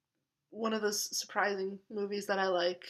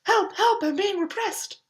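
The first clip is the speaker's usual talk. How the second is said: much higher, loud mock peasant wail; Monty Python peasant; 11 semitones higher, 7 dB louder than usual. Quoting Holy Grail to viewers in a mock peasant cry. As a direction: squeal it like the oppressed peasant. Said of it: "so many great lines in that movie".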